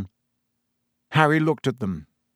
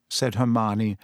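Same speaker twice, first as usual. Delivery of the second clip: plain reading voice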